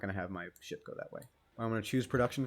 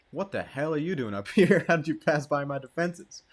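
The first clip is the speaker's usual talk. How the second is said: loud, higher, incredulous demand; mock outrage; 5 semitones higher, 9 dB louder than usual. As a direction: challenge an intruder with hammed-up indignation. Mock outrage at intruder in game.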